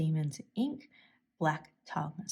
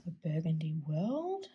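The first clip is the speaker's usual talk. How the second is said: "world" goes up in an Australian question-like rise; rising at the end. Real, not acted. Telling viewers they're in a burgundy world, rising on "world". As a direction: lift "world" up like a question though it isn't one. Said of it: "I just went so high on world".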